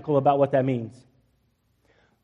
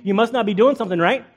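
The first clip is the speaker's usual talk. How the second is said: higher-pitched, teasing taunt; mocking; 4 semitones higher than usual. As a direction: poke fun at them with mock concern. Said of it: "Elijah began mocking them".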